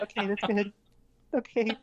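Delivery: high, laughing awkward agreement; awkward Stephanie. Retold or imitated. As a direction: play the cornered co-guest humoring her. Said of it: "poor Stephanie Holman sitting next to her going".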